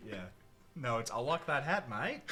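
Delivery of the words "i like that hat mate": Australian accent, louder and higher; Aussie phrase, bright and loud. Shown as a demonstration. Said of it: "That's how I get into my Aussie accent".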